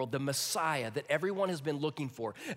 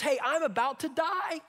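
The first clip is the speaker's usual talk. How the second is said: whiny Esau; 9 semitones higher, 4 dB louder than usual. Acted. Preacher acts out Esau's whine.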